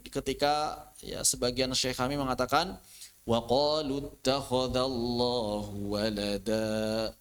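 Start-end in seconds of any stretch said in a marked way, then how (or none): 3.3-7.1 low, slow recitation of the unbelievers' words; lowered voice. Shown as a demonstration. Teacher demonstrating the lowered recitation of unbelievers' words, low and slow. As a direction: bring the voice down on this verse. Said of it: "Itu direndahkan".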